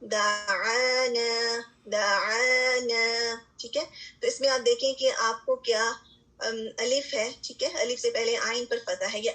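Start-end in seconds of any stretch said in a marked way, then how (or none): 0.0-3.4 دعانا chanted slowly with both long a vowels held; slow tajweed recitation of دعانا; Quranic recitation. Shown as a demonstration. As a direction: recite دعانا, drawing out each madd. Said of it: "یہ مدود جیسے آپ کے سامنے".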